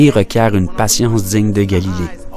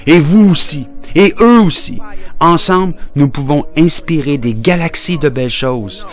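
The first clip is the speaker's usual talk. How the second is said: triumphant rallying cry; 6 semitones higher than usual. Acted. Poet including the whole audience, rousing cry. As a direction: call out to include everyone.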